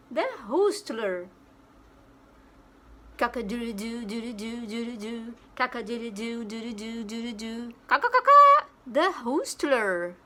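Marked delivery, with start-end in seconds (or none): 3.2-7.7 softer cock-a-doodle-doo; imitated rooster crow. Shown as a demonstration. Teacher to kids, crowing like a rooster, softer. 7.9-8.6 much higher, louder cawing; imitated bird caw. Shown as a demonstration. Teacher to kids, a loud high bird caw.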